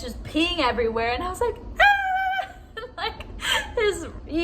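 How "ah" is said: high shriek breaking into a laugh; retold scream. Nurse reliving her shriek when she got peed on.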